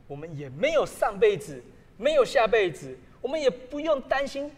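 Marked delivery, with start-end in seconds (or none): none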